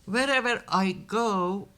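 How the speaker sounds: ordinary talk, nothing marked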